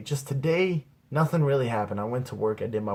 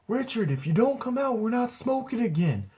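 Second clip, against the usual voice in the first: mock yell; 10 semitones higher than usual. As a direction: call out a warning to someone hiding. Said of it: "I yell".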